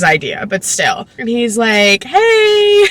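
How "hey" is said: high, drawn-out mock-friendly hello; hammed-up high-pitched greeting; awkward boyfriend greeting. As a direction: imitate an adult overdoing friendliness to a kid.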